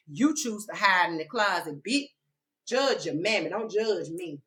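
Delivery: usual speaking voice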